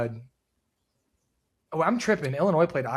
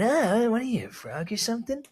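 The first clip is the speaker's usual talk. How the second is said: high, sneering villain taunt; super villain voice; 8 semitones higher than usual. Retold or imitated. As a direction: ham it up like a cartoon villain. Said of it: "like a super villain".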